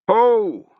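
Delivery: loud high hail; calling out. Acted. Sutri calls out under the bridge, loud. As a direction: call out into the dark. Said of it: "he called".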